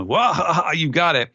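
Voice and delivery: high, hearty mock laugh into confidence; hammed-up helmsman's big chuckle; Jinbei. Host as helmsman answering his captain, high laughing assurance. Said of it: "Jinbei has, uh, parked himself".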